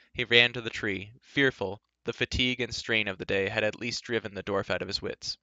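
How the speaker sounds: plain reading voice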